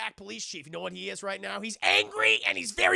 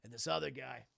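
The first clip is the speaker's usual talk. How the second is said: low, sombre Dom; 5 semitones lower than usual. Acted. Dom telling Brian how his dad died, low and sombre. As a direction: confide a painful memory, low and heavy.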